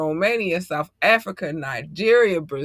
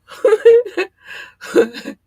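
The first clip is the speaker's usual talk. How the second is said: high laugh; 8 dB louder than usual. Real, not acted.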